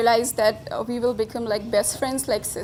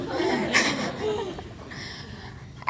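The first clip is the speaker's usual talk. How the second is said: tearful laugh; 7 semitones higher, 5 dB quieter than usual. Real, not acted. Emotional friend after apologizing.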